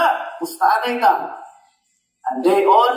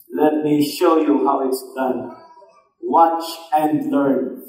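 confident Elijah; 7 semitones lower, slower than usual. Acted. Elijah voiced confidently to prophets.

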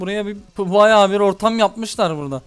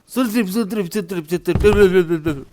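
very slow, lower mock chant of their shrieking; mocking noise; 3 semitones lower, much slower than usual. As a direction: drone it out, making fun of them. Mocking the enemies' shouting noise. (dalga geçer gibi yavaş taklit)

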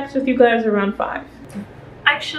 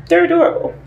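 delighted; 6 semitones higher, 4 dB louder, faster than usual. Real, not acted. Repeating with delight.

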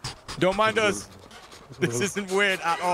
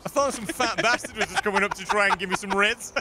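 ordinary talk, nothing marked

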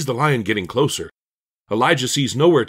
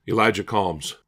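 plain reading voice

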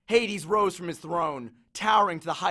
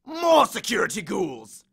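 louder, higher grumbling lament; moaning complaint; 4 semitones higher, 5 dB louder than usual. Acted. Hades moans to Percy about his staff, loud lament. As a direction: groan about the cost of it all. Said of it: "he moaned".

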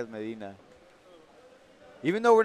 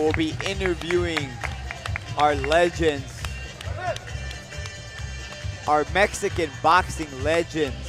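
sports commentator; 3 semitones higher, 5 dB louder than usual. Acted. Live commentary over the fight.

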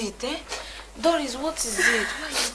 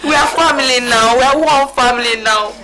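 loud, emotional forgiveness; fervent, tearful insistence; impassioned plea; 8 dB louder than usual. Acted. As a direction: plead loudly for them to stay. Pleads with weeping woman to stay.

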